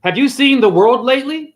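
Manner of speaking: retold incredulous retort; disbelief. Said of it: "he turns in disbelief".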